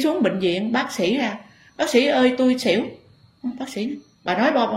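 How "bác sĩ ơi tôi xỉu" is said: patient calling doctor. Retold or imitated.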